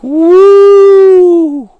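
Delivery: high loud whoop of relief; joyful whoop. Retold or imitated. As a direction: let out a big woo of relief. Relief cry in story.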